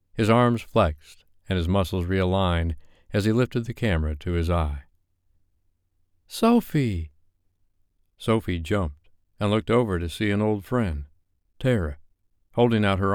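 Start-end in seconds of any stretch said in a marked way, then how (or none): 6.3-7.1 high bright call of a name across the room; excited call. A narrator's reading. Tara calling out to Sophie across the party, excited. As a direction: call her name from a distance, delighted. Friend Tara calls out greeting.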